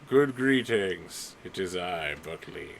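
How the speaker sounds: slightly stately creature, slow greeting; lightly voiced noble self-introduction, dropping away; Buckley. Game master as evolved Buckley greets them, mildly stately. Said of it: "Buckley is like".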